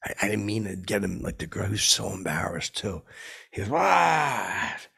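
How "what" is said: high, falling exclamation; mock indignant outburst. Man reacting to question about his shoes, mock indignant. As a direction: imitate an affronted man's drawn-out cry. Imitating startled man's angry reaction.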